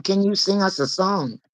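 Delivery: ordinary talk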